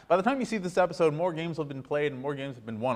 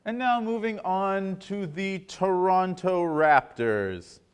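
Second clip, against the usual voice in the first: sports anchor segment intro; 4 semitones higher, much slower than usual. Acted. Host introduces hockey segment, slow announcer voice.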